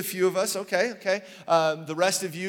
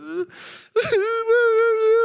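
mock crying wail; 8 dB louder than usual. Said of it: "And she just cries".

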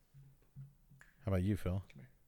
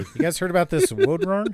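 excited; 9 semitones higher, 10 dB louder than usual. Acted. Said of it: "those spoonerisms".

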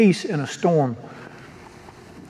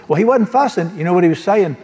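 ordinary delivery

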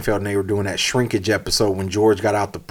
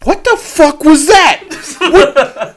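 high, loud shout of disgust; appalled mock yell; 8 dB louder, much slower than usual. Yelling at himself in the rear view, appalled mock yell. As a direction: yell it at yourself, drawn out and incredulous.

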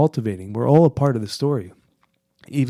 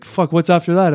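panic; 6 semitones higher, 8 dB louder than usual. Retold or imitated. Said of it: "I just had this deep panic".

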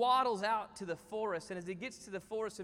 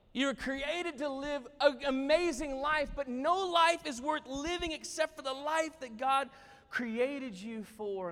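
raised, loud, emphatic declaration; impassioned preaching; 5 semitones higher, 4 dB louder than usual. Real, not acted. Preacher declares God's purpose to congregation, impassioned. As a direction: proclaim it to the whole room.

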